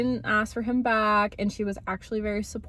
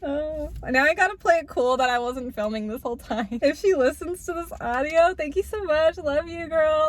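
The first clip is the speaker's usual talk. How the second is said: embarrassed laughing; 5 semitones higher than usual. Real, not acted. Friend overheard, embarrassed.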